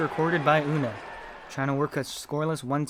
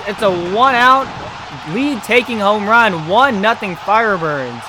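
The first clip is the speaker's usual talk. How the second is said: loud, raised announcer call after the big moment; excited play-by-play; 7 semitones higher, 12 dB louder than usual. Real, not acted. Announcer giving the new score after a homer, excited. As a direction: keep the home-run energy up, high and loud. Still calling the home run.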